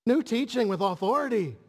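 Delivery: high, amazed "What is this?"; astonished crowd. Acted. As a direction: gasp it out in wonder. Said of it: "they reply again".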